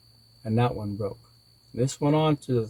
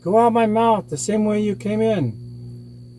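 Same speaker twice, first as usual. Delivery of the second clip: pleading monster; 10 semitones higher than usual. A narrator's reading. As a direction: wail the order as the hurting monster. Hurting monster pleads with Coyote.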